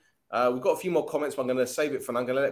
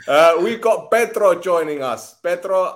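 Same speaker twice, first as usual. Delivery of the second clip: loud announcing; 6 semitones higher, 10 dB louder, slower than usual. Real, not acted. Loud slow announcement of guest.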